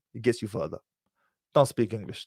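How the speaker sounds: normal talking voice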